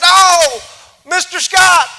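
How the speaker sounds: high, loud cowboy call; excited Texan shout. Retold or imitated. Texan rancher calling out loud to the speaker. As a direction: pop up and holler at the speaker. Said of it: "awesome Texan rich accent that I wish I could capture".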